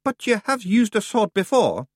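a cheeky little Feegle drawling. A narrator's reading.

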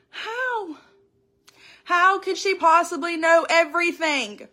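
re-living her astonishment; amazed. Retold or imitated. Woman recalling shock that a stranger knew everything, amazed.

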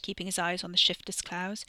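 ordinary delivery, nothing marked

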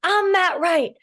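mock swaggering boast; Matt Wright boast. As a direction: brag like the famous pro after a winner. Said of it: "He's like".

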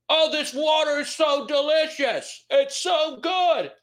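high, slow silly voice; drawn-out hammed-up squeak; squeaky mock voice. Mock drinking the bottle wrongly.

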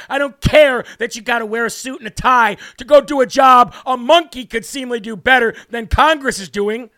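normal talking voice

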